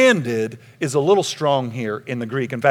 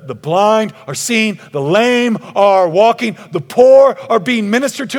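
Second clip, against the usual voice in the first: breathlessly eager messenger, voice raised; hammed-up excitement, building higher; excited report; 8 semitones higher, 10 dB louder than usual. Runner excitedly repeats the news.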